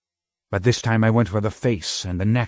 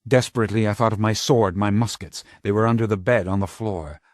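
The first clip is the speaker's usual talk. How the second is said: ordinary delivery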